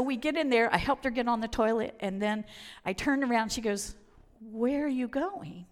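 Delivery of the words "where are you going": slow, stretched-out plea; drawn-out question. Retold or imitated. Friend calling her back from leaving, drawn-out question. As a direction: stretch each word as you call someone back. Friend calls from toilet, retold.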